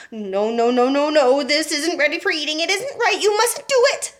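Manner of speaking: high, rising fluster building to a cry; an anxious inventor begging her to stop; fussy Mister Wonka. A narrator's reading. Wonka voiced in story.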